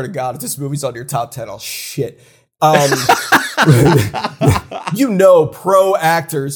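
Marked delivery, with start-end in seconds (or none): 2.9-4.9 loud real laugh that breaks out; burst of laughter. Real, not acted. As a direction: crack up at your own joke. Host cracks up at own joke.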